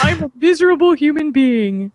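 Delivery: high, loud theatrical mimicry; mocking echo. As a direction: parody the self-pity loudly and high. Co-host echoes the gloomy remark theatrically.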